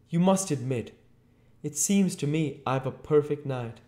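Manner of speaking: high, loud, aggrieved complaint; high-pitched, put-upon protest; miserable Mr Marvel. A narrator's reading. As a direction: argue the unfairness of it all.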